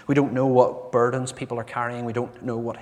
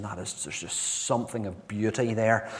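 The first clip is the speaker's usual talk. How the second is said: ordinary talk, nothing marked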